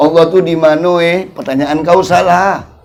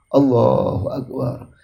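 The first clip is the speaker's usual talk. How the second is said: softly answering azan; 8 semitones lower, 8 dB quieter, slower than usual. Shown as a demonstration. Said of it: "Cara jawab azan lah diajari".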